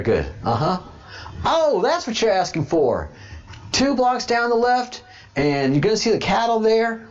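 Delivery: put-on high, fast old Texas rancher voice; old Texan man. Old Texan giving a stranger directions, high and quick. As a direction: squeak it out like an old country fella.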